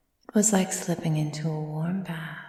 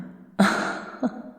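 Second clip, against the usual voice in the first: whispered mocking chuckle; 4 semitones higher than usual. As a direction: laugh off the very idea, under your breath. Vampire mocks lion becoming lamb.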